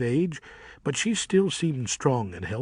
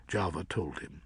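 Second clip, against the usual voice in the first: plain reading voice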